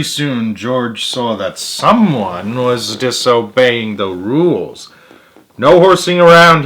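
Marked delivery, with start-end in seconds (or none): 5.6-6.6 high, loud call across the pool. A narrator's reading. George calling through his megaphone at Martha. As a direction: bellow it like a lifeguard. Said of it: "he called through his megaphone".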